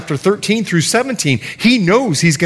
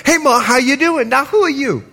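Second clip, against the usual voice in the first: high Bahamian grandmother exchange; Bahamian accent; 9 semitones higher than usual. Retold or imitated. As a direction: put on a Bahamian lilt, high-pitched. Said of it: "She's Bahamian, excuse the accent".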